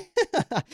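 a high, breathy little giggle. Real, not acted. Fan laughing at his own rant about Rippon, high giggle. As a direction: break into a high giggle at your own joke.

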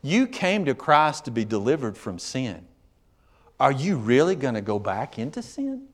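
ordinary talk

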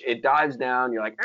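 normal talking voice

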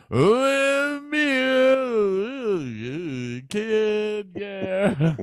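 high, slurred mock drunk rock singer; drunk singer impression. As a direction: ham up a wasted singer slurring on stage. Drunk singer mumbling on stage.

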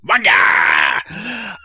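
loud, high comic yell of the word; shouted catchphrase. Retold or imitated. Friends quoting their film catchphrase at each other, comic shout. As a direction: bark it out like a running joke. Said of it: "trigger the response".

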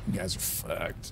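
low unvoiced whisper. DM taunting players in a whisper.

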